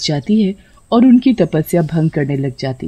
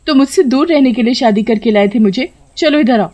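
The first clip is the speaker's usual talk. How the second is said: high teasing scold; playful bride; 6 semitones higher than usual. A narrator's reading. Sheela pulls her husband close, playful. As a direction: tease him closer.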